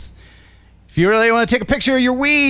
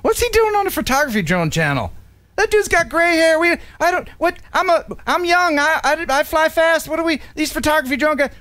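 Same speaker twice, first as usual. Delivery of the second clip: put-on higher, whiny young fan voice; mocking young viewer; 8 semitones higher than usual. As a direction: play a scoffing young pilot. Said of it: "and you're wondering".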